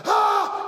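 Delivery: high burst of relief; relieved cry. Retold or imitated. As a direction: let out the joy. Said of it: "he's like".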